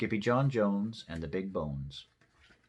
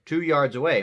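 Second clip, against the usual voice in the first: ordinary delivery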